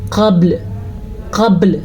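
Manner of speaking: قبل with a bounced ب and stop on ل; qalqala on ba. Shown as a demonstration. Teacher showing students the qalqala in قبل, bounced stop. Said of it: "ہم نے پڑھنا ہے".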